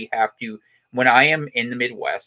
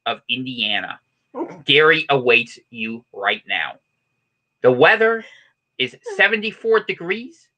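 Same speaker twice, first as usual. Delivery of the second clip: higher, punched announcer delivery with pauses; mock news report; 6 semitones higher than usual. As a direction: read it like a hammed-up local newscaster.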